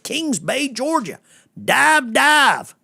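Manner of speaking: high, hammed-up read of a caller's message; mock caller voice. Host reading out Nathan's message, mocking. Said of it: "I always love hearing Nathan".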